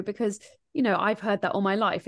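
usual speaking voice

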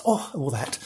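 plain reading voice

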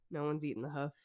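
usual speaking voice

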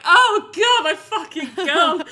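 high loud choked-up laugh; overwhelmed. Retold or imitated. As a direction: burst with feeling at the reading. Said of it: "and I was like".

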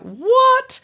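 high, loud shocked what. Retold or imitated. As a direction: squeal it in disbelief.

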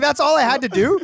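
high disbelieving squeak; shocked it was that easy; incredulous. Retold or imitated. Incredulous retold reply.

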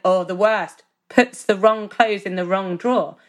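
ordinary talk, nothing marked

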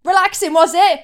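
loud mocking sarcasm; sarcastic. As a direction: throw the word back at her. Sarcastic retort at sleeping wife.

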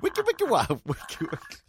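high, playful rap catchphrase through laughter; laughing pop-rapper imitation. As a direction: toss out a goofy high-pitched scratch-style hook. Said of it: "I sound like [name]".

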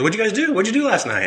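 high, mock-innocent dad voice; knowing dad faking curiosity; probing dad. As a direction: imitate the dad asking as if he knows nothing. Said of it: "He goes".

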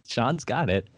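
usual speaking voice

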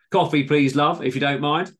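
loud, high-pitched chummy request; too loud, over-familiar. A narrator's reading. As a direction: order loudly, far too informally. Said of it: "too loudly and too informally".

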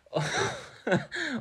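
sudden high-pitched laugh. Real, not acted. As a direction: burst into a squeaky laugh. Laughing during Patronus confession.

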